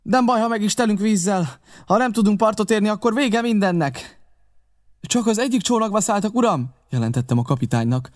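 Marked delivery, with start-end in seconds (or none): 0.0-4.2 high, pressing command; urgent captain. Acted. Captain to the crew as boats approach, urgent and high. 5.0-6.7 high, alert voice; urgent report. Acted. Narrator reporting pirate boats to captain, urgent and high.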